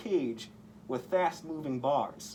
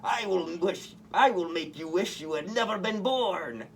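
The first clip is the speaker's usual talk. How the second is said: higher villain voice, a bit put on; menacing Goblin voice; 4 semitones higher than usual. A narrator's reading. Goblin threatening Spider-Man, mild villain snarl. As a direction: threaten him like a cartoon villain. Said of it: "replied the Goblin".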